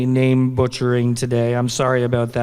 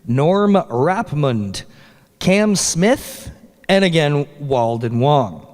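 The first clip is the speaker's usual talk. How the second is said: normal talking voice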